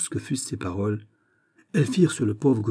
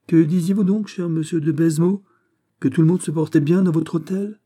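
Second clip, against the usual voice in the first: higher, louder, casual needling; nonchalant; 8 semitones higher, 5 dB louder than usual. A narrator's reading. Bishop needles the governor over his sick prisoner, nonchalant. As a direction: toss the question out carelessly. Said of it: "dit négligemment Aramis".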